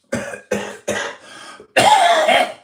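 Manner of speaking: loud, explosive coughing fit; real choking cough rising to a high strained wheeze. Real, not acted. Streamer choking on a sniffed spray, coughing hard. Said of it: "I choke myself there".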